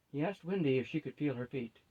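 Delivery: plain reading voice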